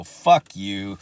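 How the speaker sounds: loud defiant fuck you flung at him; retold insult. At the drive-through window, cursing out the old neighbor, loud and defiant. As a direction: spit it at the manager through the drive-through. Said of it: "and I go".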